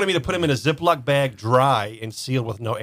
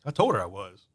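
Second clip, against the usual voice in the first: softer, lower, matter-of-fact; retold calm; 5 semitones lower, 5 dB quieter than usual. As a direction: imitate an unruffled man stating it quietly. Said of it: "he was just as calm".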